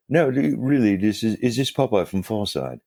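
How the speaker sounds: hammed-up British accent, insisting; imitating a British accent. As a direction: play the British caller pressing his point. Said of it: "with a British accent".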